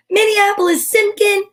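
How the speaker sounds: high, loud call across the house; yelling a name. A narrator's reading. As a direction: call the child in sharply. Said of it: "yelled Mom".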